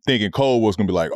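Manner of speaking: ordinary talk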